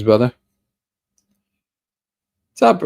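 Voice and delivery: ordinary talk, nothing marked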